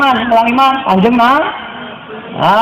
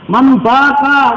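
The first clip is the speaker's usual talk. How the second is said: drawn-out recitation; 3 semitones higher, much slower than usual. Acted. Arabic quoted, drawn out.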